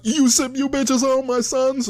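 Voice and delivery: high, loud, drawn-out tough act; hammed-up yell of a rapper acting tough; mock tough. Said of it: "wants to get tough".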